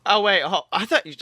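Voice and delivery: normal talking voice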